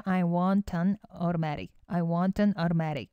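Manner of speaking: want an said as one word, slowly; linked pronunciation. Shown as a demonstration. Teacher drills the linking in want an, slow and smooth. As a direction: glide the t of want straight into an. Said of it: "연음이 되기 때문에요".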